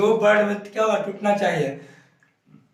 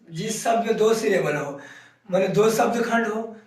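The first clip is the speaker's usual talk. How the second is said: normal talking voice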